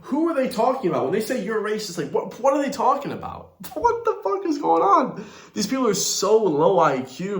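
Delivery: high, laughing what-the-fuck disbelief; incredulous disbelief. Real, not acted. Vlogger exasperated at clip's accusations.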